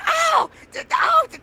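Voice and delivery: high-pitched imitated screams, loud and broken off; mock hysterical screaming; screaming mom. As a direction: shriek like a panicking mother. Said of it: "And she starts screaming".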